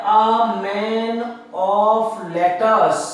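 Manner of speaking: drawn out word by word for writing down; slow dictation. Shown as a demonstration. Teacher giving students a phrase to write down, slow dictation. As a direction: dictate "a man of letters" very slowly. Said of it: "आप लिख लीजिए इसको".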